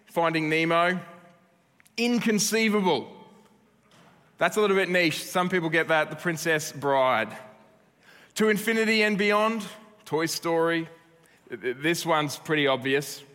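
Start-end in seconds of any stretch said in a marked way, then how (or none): 2.0-3.1 lightly voiced, higher, mock outrage; a hint of the movie villain; Vizzini quote. Quoting The Princess Bride line. 8.3-9.6 a light heroic space ranger voice, higher; mildly hammed-up heroic call; Buzz Lightyear quote. Quoting Toy Story's space ranger.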